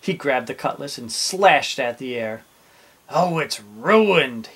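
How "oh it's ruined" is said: loud, drawn-out theatrical moan. A narrator's reading. Captain over his ruined cutlass, moaning. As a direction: wail it slowly in dismay. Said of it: "he moaned".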